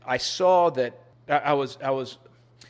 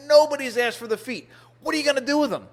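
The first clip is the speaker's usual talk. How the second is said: higher, clipped-off insistence, "Nobody's asked for the feet" falling at the end; a mock-offended butcher defending her trade; imitating an indignant butcher; 12 semitones higher, 5 dB louder, faster than usual. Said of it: "she was angry at me".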